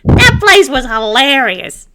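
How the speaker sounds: high, loud and slow with glee; gleeful. Acted. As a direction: savor the memory, delighted. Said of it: "grasped at his sides to laugh".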